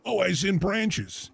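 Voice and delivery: usual speaking voice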